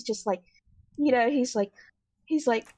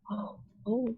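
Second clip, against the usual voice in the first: soft, low nervous mutter; muttering to himself; 3 semitones lower, 9 dB quieter than usual. Acted. Bird character slowly calming down, muttering to himself. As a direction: mutter little worried sounds under your breath. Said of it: "he's still kind of muttering to himself".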